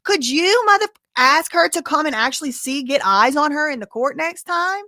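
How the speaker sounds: high, loud, exasperated comeback; indignant retort. Acted. Host answering back to the interrupting judge, indignant.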